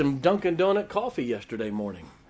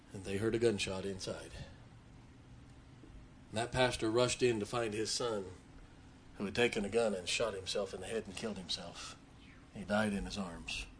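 softer, lower, heavy-hearted telling; hushed and grave; 6 semitones lower, 13 dB quieter than usual. Real, not acted. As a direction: tell it quietly, weighed down by grief. Tragic suicide story, hushed.

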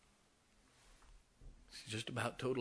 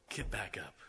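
whispered urging; 2 semitones lower than usual. Real, not acted. Pastor whispers to grieving Melissa, hushed urging. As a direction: whisper it like a secret encouragement. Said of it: "repeated softly, whispered".

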